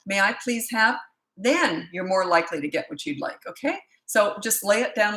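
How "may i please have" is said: politely asked, slightly raised, measured voice; polite request. Shown as a demonstration. Parent showing a five-year-old how to ask, polite. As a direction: model the nice way to ask. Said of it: "if you talk like this".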